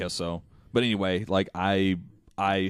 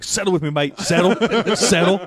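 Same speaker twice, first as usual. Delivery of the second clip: higher, louder Australian bloke calming an animal; loud Aussie wrangler; 8 semitones higher, 6 dB louder than usual. Retold or imitated. Retelling the kangaroo guy, higher and louder Aussie voice. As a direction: call it out like a wrestling Aussie. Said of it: "a crazy Australian".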